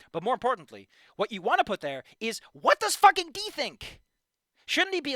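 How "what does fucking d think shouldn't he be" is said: slow, high, loud frustration; raised-voice angry question; exasperated demand. Real, not acted. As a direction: hit each word, loudly fed up. Exasperated demand about the writing.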